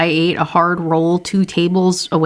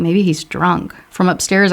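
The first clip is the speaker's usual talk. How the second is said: plain reading voice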